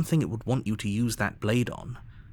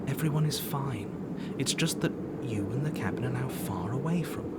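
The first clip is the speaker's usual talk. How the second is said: unvoiced, gentle whisper; calm the player with a hush; soothing whisper; 3 semitones higher than usual. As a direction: narrator whispering reassurance.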